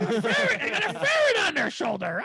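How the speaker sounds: high granny squawk; cartoon old lady.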